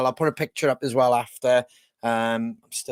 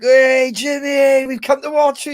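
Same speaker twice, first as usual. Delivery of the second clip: excited; 11 semitones higher, 7 dB louder than usual. Retold or imitated. Calling out to friend Jimmy.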